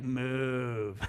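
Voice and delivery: low, curt one-word dismissal; curt Nick Burns. Retold or imitated. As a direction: bark it out like the rude computer guy. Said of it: "He was like".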